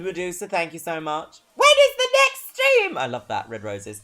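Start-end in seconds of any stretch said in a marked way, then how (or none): 1.5-3.0 very high, loud, drawn-out mock voice; hammed-up piercing question; put-on squeal. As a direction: squeal it slowly in a silly high voice.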